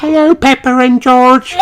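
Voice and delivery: high, sing-song little lamb voice; a chirpy greeting from a friend; Susie Sheep. A narrator's reading. Susie Sheep greeting Peppa and George, high chirpy voice.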